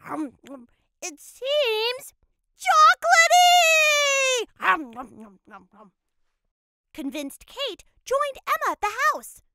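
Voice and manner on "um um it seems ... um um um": chewing while talking; munching between words; mouth full. A narrator's reading. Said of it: "already chewing a piece of the door".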